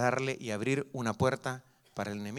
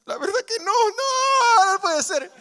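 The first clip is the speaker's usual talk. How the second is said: much higher, louder crying wail; child crying; 7 dB louder, slower than usual. Retold or imitated. Preacher as a little boy who believes the lie, crying loudly.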